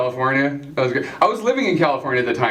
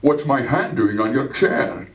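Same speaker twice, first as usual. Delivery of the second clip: Sean Connery voice; 3 semitones lower, slower than usual. Acted. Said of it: "He'd be just like".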